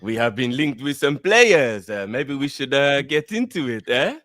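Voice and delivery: hammed-up French accent. Host opening the show for his co-host, mock French accent. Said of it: "do the show in a French accent".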